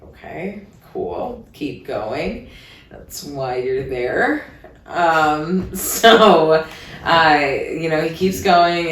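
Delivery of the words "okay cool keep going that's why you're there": low, soft, dry go-ahead; muttered deadpan. Retold or imitated. To the guy going down on her, soft and dry. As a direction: say it flat under your breath. Deadpan reply during sex.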